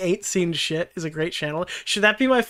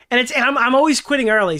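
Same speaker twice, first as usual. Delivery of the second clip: exasperated outburst; 6 semitones higher, 6 dB louder, faster than usual. Real, not acted. Frustrated outburst.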